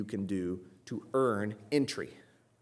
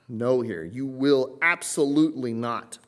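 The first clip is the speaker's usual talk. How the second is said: louder, with weight on "absolutely not"; emphatic no; 5 dB louder than usual. Shown as a demonstration. Preacher spelling out Christ's warning to the congregation, emphatic "absolutely not". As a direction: punch "no" and drive home "absolutely not". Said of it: "So this is an emphatic".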